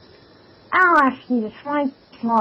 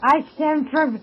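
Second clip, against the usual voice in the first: hammy fake Swedish accent; 3 semitones higher than usual. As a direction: put on a bad Swedish accent. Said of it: "putting on a very fake Swedish accent".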